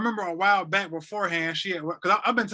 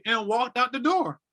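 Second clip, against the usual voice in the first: usual speaking voice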